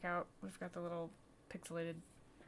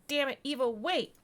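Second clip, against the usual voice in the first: high, loud exasperated cry; urgent shout; 7 semitones higher, 16 dB louder than usual. Acted. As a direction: shout to stop someone leaving. Doctor urgently stopping departing colleague.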